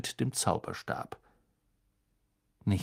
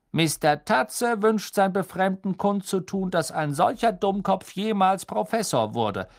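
higher, louder cheeky tone; mocking map voice; 9 semitones higher, 4 dB louder than usual. The map insulting Snape in a cheeky voice. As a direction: read it like a prank note.

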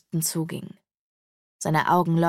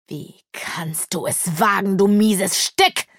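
hateful demon rage; slower than usual. A narrator's reading. Wounded demon to his attacker, hateful fury. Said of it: "verzerrte sich vor Hass".

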